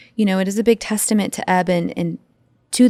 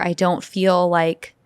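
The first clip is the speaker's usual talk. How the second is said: normal talking voice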